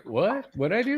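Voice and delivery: high-pitched innocent protest; mock bewilderment. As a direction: play clueless, voice rising high. Acting out a clueless man's reaction.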